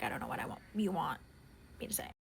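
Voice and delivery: a tired, dropped mumble; mumbled reply. Retold or imitated. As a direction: trail off like you're giving up. Tired of answering dad.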